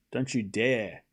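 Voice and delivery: ordinary talk, nothing marked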